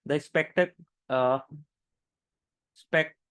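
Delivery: halting failed tries at "spectac-", shrinking to "spec-"; "spectac-" and "spec-" left unfinished, with hesitant gaps; stumbling over pronunciation. Real, not acted. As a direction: get stuck on "spectac-", pause, retry and break off. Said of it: "मेरे को ही pronounce करते नहीं बन रहा है".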